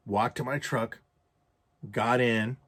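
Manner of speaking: ordinary talk, nothing marked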